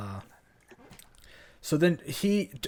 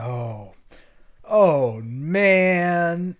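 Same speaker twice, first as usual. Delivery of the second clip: loud, drawn-out mock groan of dismay; hammed-up punchline before the laugh track; sitcom dismay; 4 semitones higher, 7 dB louder, much slower than usual.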